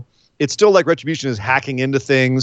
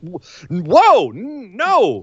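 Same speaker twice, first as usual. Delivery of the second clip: stuttered, high-pitched protest; mock alarm; 5 semitones higher, much slower than usual. Fans hearing the wrestler's early voice, mock alarm. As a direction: cut him off in hammed-up dismay. Acting out fans' shocked reaction.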